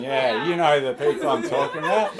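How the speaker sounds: ordinary talk, nothing marked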